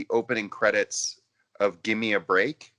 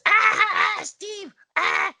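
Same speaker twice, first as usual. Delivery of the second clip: high, loud crow cackle; cackling crow; 6 dB louder, slower than usual. Retold or imitated. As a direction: squawk the name like a mischievous cartoon crow. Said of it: "his crow is always".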